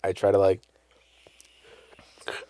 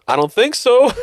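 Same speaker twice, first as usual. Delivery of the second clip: put-on kid's reply; 10 semitones higher than usual. Jimmy answers his dad.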